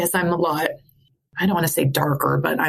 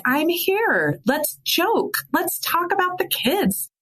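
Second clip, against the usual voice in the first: high, drawn-out playful pleading; playful urging; 12 semitones higher, slower than usual. Retold or imitated. Husband urging wife to joke about kids, playful. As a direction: coax brightly in a much higher pitch.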